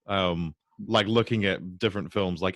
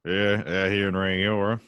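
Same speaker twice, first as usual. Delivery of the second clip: broad rural Canterbury accent; southern NZ farmer accent. Shown as a demonstration. Rural Canterbury farmer talk, broad Kiwi accent. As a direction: lean into a Kiwi farmer twang. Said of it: "Down south with all the farmers".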